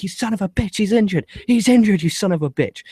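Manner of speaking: high-pitched mock outrage; retold shouting. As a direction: imitate a wrestler yelling an accusation. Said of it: "And he's like".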